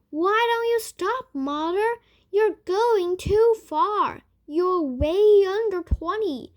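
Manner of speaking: high, loud, panicked pleading; frightened daughter crying out; shrill alarm. A narrator's reading. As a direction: beg her to stop before it's too late. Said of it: "shrill note of alarm in her voice".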